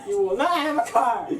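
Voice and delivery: over-invested movie-quote outburst; emotional outburst. Pretend. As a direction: burst out as if overcome by emotion. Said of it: "I just get really emotional".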